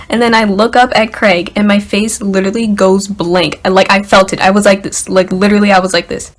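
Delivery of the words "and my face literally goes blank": ordinary talk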